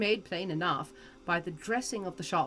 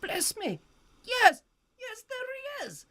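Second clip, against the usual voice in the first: put-on much higher delighted old man; excited; 14 semitones higher, 3 dB louder than usual. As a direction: cry out in happy recognition. Scrooge delighted seeing Dick.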